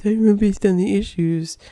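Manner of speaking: nasal mocking whine; whiny voice. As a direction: whine it like a smug voter. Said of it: "this whiny voice".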